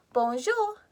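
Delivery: "Bonjour" said bohn-ZHOOR with a soft French j; French pronunciation. Shown as a demonstration. Teacher modelling a word for children. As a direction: model "Bonjour" slowly and clearly for kids to copy. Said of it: "Repeat after me".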